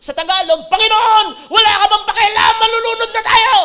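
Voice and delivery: high, loud panicked cry; panicked shout. Retold or imitated. Peter shouting at Jesus in the storm, panicked. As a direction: scream it like sinking in a storm. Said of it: "sumigaw siya".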